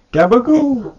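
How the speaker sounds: very high, loud, drawn-out mock Italian cry; high-pitched catchphrase squeal. Player interrupting with Italian catchphrase, high comic squeal. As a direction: squeal it slowly and loudly as a joke.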